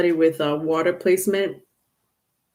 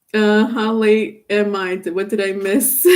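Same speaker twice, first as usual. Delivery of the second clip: ordinary delivery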